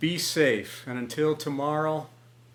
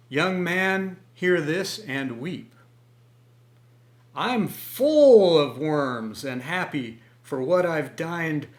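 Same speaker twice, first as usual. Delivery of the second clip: lively, louder storytelling verse; expressive poem recital; poem reading; 3 semitones higher, 5 dB louder, slower than usual. Acted. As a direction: read the rhyming tale with relish for listeners. Reads a comic poem aloud.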